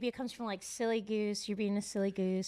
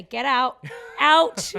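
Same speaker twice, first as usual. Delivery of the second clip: shouted dismissal, much louder; mock shout; 2 semitones higher, 14 dB louder, slower than usual. Deli guys throwing out a customer's wife, mock shout. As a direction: bellow it like throwing someone out.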